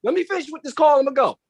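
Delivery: high, nagging fed-up complaint; exasperated high voice. Retold or imitated. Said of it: "You go".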